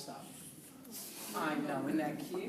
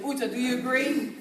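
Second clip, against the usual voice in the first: ordinary talk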